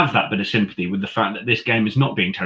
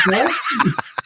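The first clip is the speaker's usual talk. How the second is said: bright high burst of laughter; 14 semitones higher than usual. Real, not acted. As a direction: laugh at your own joke. Laughs at her own joke.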